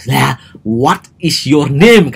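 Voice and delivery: slow, mouth wide open, confident English. Shown as a demonstration. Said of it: "Kayak gitu, guys".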